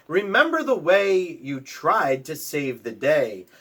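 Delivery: plain reading voice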